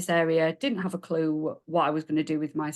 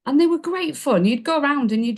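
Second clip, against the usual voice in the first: much higher and louder, gleeful; excited recall; 8 semitones higher, 6 dB louder than usual. Real, not acted. Guest remembers giggly parties, excited and loud. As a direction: burst out with the fun memory.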